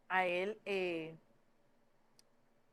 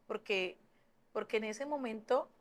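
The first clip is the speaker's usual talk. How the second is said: normal talking voice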